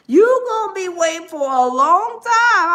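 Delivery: loud teasing warning. Real, not acted. Taunting, repeated louder.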